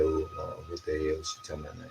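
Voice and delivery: low, soft, fast grumbling under the breath; quick low mutter of disbelief; muttered exasperation. Retold or imitated. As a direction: mutter it like a kid rolling his eyes. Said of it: "And we sitting up there".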